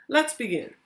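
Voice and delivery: ordinary delivery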